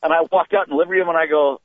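ordinary talk, nothing marked

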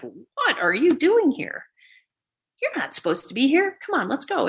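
stern nurse. Retold or imitated. Nurse scolding intruder.

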